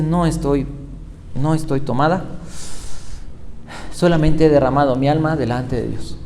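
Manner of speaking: meek reply. Acted. Woman answers the accusing priest.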